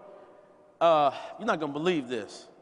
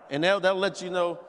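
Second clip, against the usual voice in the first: usual speaking voice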